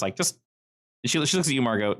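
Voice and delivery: ordinary talk, nothing marked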